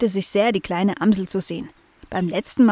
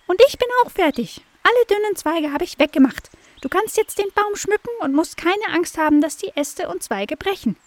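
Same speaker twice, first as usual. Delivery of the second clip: very high chirpy blackbird; high bird voice; 10 semitones higher than usual. Acted. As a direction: squeak it like a tiny bird. Blackbird reports.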